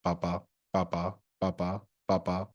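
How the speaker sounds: monotone chant in a mimicking voice; mock repetitive papa. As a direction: imitate a droning, repeated "papa". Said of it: "begins the horrifying earring sound".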